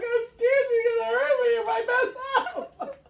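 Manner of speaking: high, laughing half-cry; laughing, mock scared. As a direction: giggle out a mock frightened confession. Retold fearful answer, laughing.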